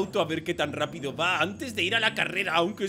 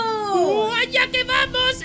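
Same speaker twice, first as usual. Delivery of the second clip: high, loud, drawn-out shout; thrilled launch cry; 14 semitones higher, 9 dB louder, much slower than usual. Acted. Racer proves top speed to rival, thrilled shout. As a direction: holler as the car takes off.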